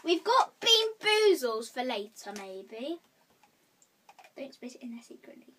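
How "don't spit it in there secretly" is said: soft unvoiced whisper. Real, not acted. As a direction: whisper it on the side. Warning a friend quietly.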